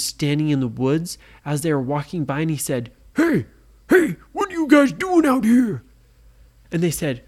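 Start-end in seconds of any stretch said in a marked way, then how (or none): 3.1-5.8 high, loud pirate yell; pirate shout. A narrator's reading. Pirate guard catching the kids, shouting high and loud.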